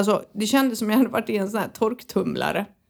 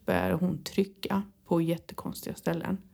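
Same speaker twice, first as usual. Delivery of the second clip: ordinary talk, nothing marked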